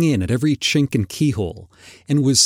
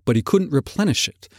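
ordinary delivery, nothing marked

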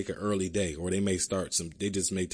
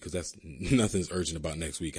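ordinary talk

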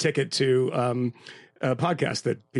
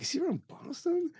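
quoting the producer; 13 semitones higher, 9 dB quieter than usual. Retold or imitated. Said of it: "was like".

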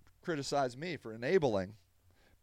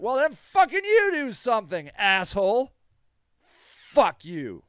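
higher, louder cursing at critics; angry outburst; 6 semitones higher, 6 dB louder than usual. Real, not acted. Advocate swears at Monday morning quarterbacks, angry. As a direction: spit it at the armchair critics. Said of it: "I got anger issues".

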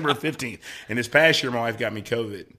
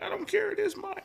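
put-on much higher, bratty kid voice; childish whine; 5 dB quieter than usual. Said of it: "I was like".

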